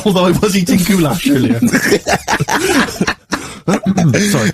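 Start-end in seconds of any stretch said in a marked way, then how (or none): 1.6-4.2 high breathless laugh at himself; real laugh. Real, not acted. Host laughing at himself after coughing, high laugh. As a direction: laugh it off, higher.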